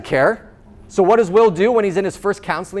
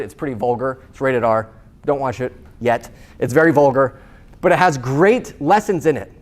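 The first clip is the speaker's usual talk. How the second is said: ordinary delivery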